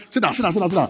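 ordinary talk, nothing marked

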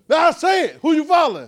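high, loud, pushing the crowd to answer; loud preaching call. Real, not acted. Preacher calling the congregation to raise hands, loud and high. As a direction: call out to the room and demand hands.

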